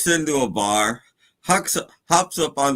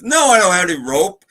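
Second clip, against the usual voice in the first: quick, loud, higher-pitched outburst; exasperated bartender; 7 semitones higher, 7 dB louder, faster than usual. Acted. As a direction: snap back like a fed-up bartender. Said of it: "The bartender says".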